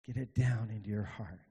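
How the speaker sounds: normal talking voice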